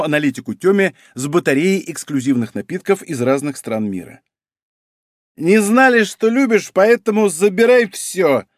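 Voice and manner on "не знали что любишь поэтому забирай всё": tipsy high and loud; slightly drunk, loud and slow. Acted. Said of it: "заявила шатающаяся боярышня".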